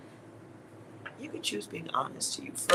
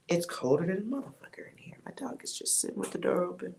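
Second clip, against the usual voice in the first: whispering; 5 semitones higher, 6 dB quieter, faster than usual. Real, not acted. Quick aside about the cold.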